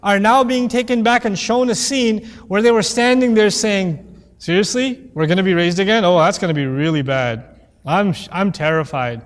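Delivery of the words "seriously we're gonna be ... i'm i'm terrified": low, mocking disbeliever's voice; sarcastic mock fear. Disbelievers on Judgment Day mocking resurrection, sarcastic low voice. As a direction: sneer at the idea of being raised again. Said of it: "making this statement sarcastically".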